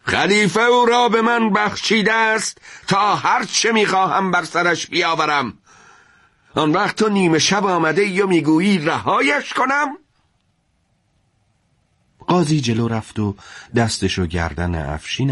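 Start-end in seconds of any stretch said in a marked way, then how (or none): 0.0-10.0 furious, high-pitched yell of rage; angry shout. A narrator's reading. Afshin yells at the judge who came at midnight, furious shout. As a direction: bellow it in outrage, voice jumping up. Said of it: "افشین با خشم فریاد زد".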